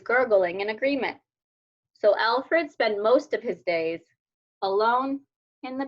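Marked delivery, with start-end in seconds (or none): none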